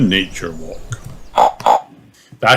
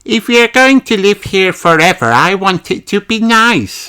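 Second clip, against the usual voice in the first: high, loud little lamb voice; high child voice; Susie Sheep's voice; 11 semitones higher, 4 dB louder than usual. Acted. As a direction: play a fussy little sheep.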